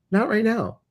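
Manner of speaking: high, gentle reassuring voice; voice of the universe. Retold or imitated. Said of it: "the universe is trying to say".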